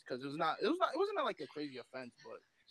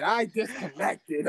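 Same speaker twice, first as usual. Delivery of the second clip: slow, louder "I disconnected"; slow drawn-out; 3 semitones higher, 5 dB louder, much slower than usual. Real, not acted. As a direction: stretch every syllable for effect. Drawn-out repeat.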